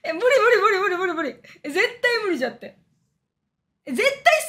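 real laughing disgust; grossed-out squeal. Real, not acted.

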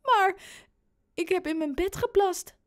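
high mock-tearful child voice; fake crying. A narrator's reading. Boy fooling dad about wet bed, fake tearful. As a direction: wail it as if really crying. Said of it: "alsof hij echt huilt".

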